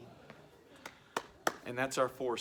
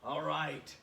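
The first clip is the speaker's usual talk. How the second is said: usual speaking voice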